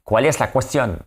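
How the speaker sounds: high-pitched, put-on Spanish accent in French; mock Spanish-French accent. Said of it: "Avec, euh, mon accent espagnol, français".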